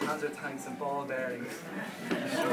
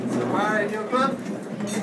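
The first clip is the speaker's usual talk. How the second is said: calling out to Barry; 5 semitones higher, 7 dB louder, much slower than usual. Real, not acted. Calling to groom at toast.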